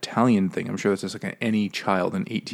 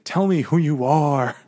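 slow, higher, louder plea to the donkey; dramatic plea; 7 semitones higher, 5 dB louder than usual. Retold or imitated. Pinocchio demands the dying donkey name himself, slow dramatic plea. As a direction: lean in and demand slowly, with weight.

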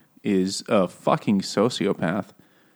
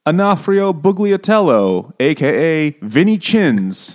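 slow, louder, higher formal announcement of a full name and alias; court announcer; 7 semitones higher, 5 dB louder, slower than usual. Retold or imitated. Podcaster mocks a court reading a mobster's real name, slow and grand. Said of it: "and it's like".

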